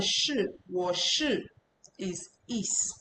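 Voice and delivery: "shi" slowly drawn out with a falling fourth tone. Shown as a demonstration. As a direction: drop "shi" sharply, slowly. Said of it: "The other one".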